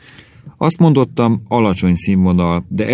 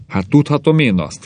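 higher-pitched exasperated outcry; indignant husband, pitched up; exclaimed; 7 semitones higher than usual. A narrator's reading. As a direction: cry out in frustration. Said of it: "kiáltott Rubner".

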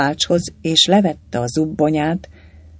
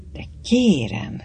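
slow, higher, softened protest; aghast; 5 semitones higher, 4 dB quieter than usual. A narrator's reading. Hlavács taken aback by Galamb's demand, aghast. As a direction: draw it out in stunned disbelief. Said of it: "hüledezett Hlavács".

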